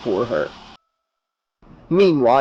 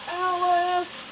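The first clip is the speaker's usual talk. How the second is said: very high, soft tiny head crying out; 7 dB quieter than usual. Acted. Rick's tiny head calling to his sister, crying. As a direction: cry out small and far away. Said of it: "Rick cried".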